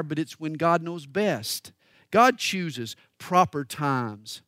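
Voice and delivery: ordinary delivery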